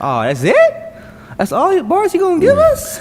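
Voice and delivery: high-pitched protest of disbelief; mock outrage. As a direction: build from surprise to loud complaint. Acting out disbelief at short verse.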